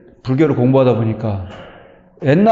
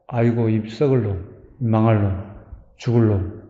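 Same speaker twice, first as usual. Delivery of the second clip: a lower, softer slip of a curse, half under the breath; muttered curse; 5 semitones lower, 6 dB quieter than usual. Retold or imitated. Preacher mimicking a habitual curse, low and muttered. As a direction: let the old curse slip out quietly. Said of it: "이렇게 튀어나오는 거예요".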